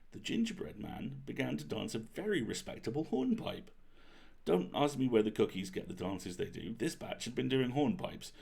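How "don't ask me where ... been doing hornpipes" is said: ordinary delivery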